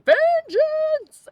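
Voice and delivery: high, loud ghostly wail of vengeance; ghost screaming. Retold or imitated. Imitating the Daggerfall ghost screaming its cry. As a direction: howl it like a haunting ghost.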